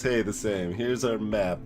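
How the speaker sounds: ordinary talk, nothing marked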